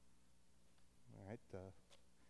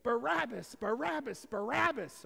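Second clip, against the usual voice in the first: high, rhythmic imitation of a chanting crowd; cheering crowd; 11 semitones higher, 6 dB louder than usual. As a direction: chant the name like adoring fans. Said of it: "crowds of people cheering my name".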